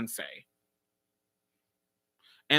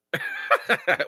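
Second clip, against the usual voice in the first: short very high squeal of laughter. Real, not acted. Laughing at the answers.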